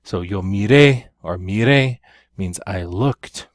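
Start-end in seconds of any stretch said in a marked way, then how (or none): 0.4-2.0 "miré or mire" with each final e punched louder; loud final syllable on "miré" and "mire"; stress on last syllable. Shown as a demonstration. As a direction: hit the endings, mi-RE both times. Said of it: "important that you stress that last syllable".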